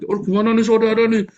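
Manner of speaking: high, loud cry of fake alarm; hammed-up yelling at being caught; mock panicked yell. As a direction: act out a panicky shout for help. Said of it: "Saya pernah nggak teriak".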